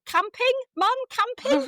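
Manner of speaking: high, loud 'camping'; daughter prompting. Retold or imitated. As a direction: prompt the forgotten word. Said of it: "what is it?".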